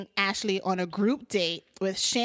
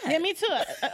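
usual speaking voice

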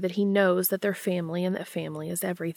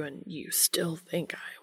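wavering whisper; 6 dB quieter, slower than usual. A narrator's reading. Dean choking on his words to Castiel, wavering whisper. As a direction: voice wavering, barely above a whisper. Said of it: "His voice wavers as he trails off".

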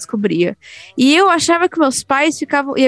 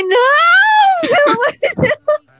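crying protest; much slower than usual. Retold or imitated. Little girl crying out to dad, high tearful wail. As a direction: beg through mock tears.

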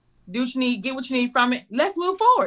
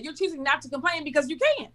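ordinary talk

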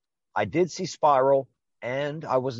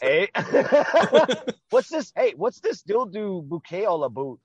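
high, hammed-up questioning; mocking Canadian-style questioning; mock silly voice; 6 semitones higher, 4 dB louder than usual. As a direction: poke fun at the bouquet in a put-on voice.

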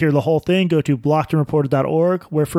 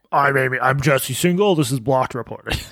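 mock dropped voice; 2 semitones lower than usual. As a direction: lightly parody a guttural founder voice. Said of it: "Should we start doing that?".

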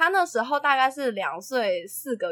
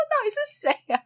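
baffled exclamation; 12 semitones higher than usual. Retold or imitated. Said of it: "我就说".

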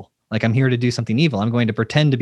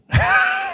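sudden high-pitched laugh; high laugh burst. Real, not acted. Shared joke about pushing hard.

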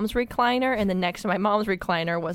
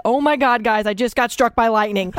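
mom's panicked outcry; 3 semitones higher, 7 dB louder than usual. Retold or imitated. Mom crying out after the camera flash, loud and alarmed. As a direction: cry out in shock like a frightened mom. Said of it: "And she said".

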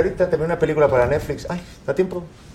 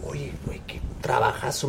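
hushed aside; 10 dB quieter than usual. Retold or imitated. Retold aside to Tenoch.

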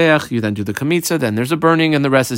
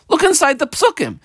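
high-pitched, incredulous retort; incredulous objection. Acted. Student objecting that the verse already says it, incredulous. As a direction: object in a much higher voice. Acted objection to the Gemara's question.

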